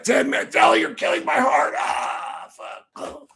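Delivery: high strangled cry of pain; hammed-up dying shout; mock heart attack. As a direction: clutch your chest and squeal.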